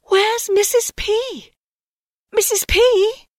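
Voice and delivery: high, urgent mother owl calling out. A narrator's reading. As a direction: call for the maid in a hurry. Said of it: "his mother said urgently".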